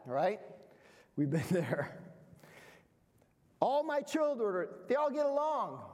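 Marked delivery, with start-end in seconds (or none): 3.6-5.8 high, sing-song mock pride; mock naive pride. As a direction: boast like a naive parent. Mocking naive parent belief.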